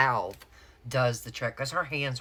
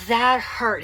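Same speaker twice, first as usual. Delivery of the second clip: high, loud retold protest; mock-indignant cry, pitched up and loud; pained outcry; 8 semitones higher, 8 dB louder than usual. Patient retelling reply to doctor who hurt her hands, pained outcry. Acted reply after painful hand exam.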